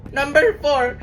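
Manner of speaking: high, slow, tearful rage; hammed-up upset teacher, drawing words out; very angry teacher. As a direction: wail the quiz question as a personal grievance. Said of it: "Ba't galit na galit siya?".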